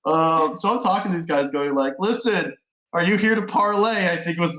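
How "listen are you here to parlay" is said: hammed-up fake Southern drawl, pitched up; a lightly done, bad Southern accent; cowboy parley in a poor Southern drawl. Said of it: "the worst Southern drawl that anyone has ever heard".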